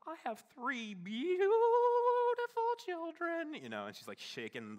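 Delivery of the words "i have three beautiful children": put-on much higher and louder, slow and wavering, like a shaky elderly storyteller; old lady's voice. Mimicking the old lady telling her family story, high and quavering. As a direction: play the sweet old grandma proudly telling her story. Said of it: "And she would say".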